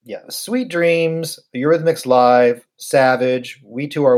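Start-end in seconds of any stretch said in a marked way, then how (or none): none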